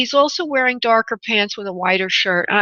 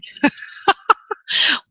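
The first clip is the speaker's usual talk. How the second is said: short, higher-pitched burst of laughter; amused laugh; 7 semitones higher than usual. Real, not acted. Host joking that the caller disappeared, amused laugh. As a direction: laugh at your own joke.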